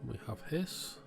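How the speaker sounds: ordinary delivery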